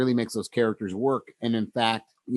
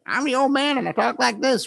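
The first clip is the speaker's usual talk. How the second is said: much higher, cartoonish old man; 13 semitones higher than usual. Shown as a demonstration. Explaining radio characters, demos a cartoonish high old man voice. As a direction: play a radio old codger, hammed up and far from your own voice. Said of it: "very distinct and almost cartoonish".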